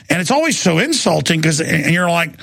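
normal talking voice

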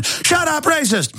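high-pitched sneering mock shout; mocking accuser. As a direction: squeal it like a hysterical accuser.

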